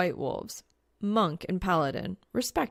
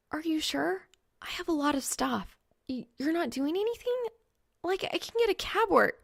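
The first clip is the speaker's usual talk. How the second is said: higher, quick flustered checking; eager girl; 10 semitones higher than usual. Acted. Reaper double-checking Soul's offer, higher and eager. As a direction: babble her worries.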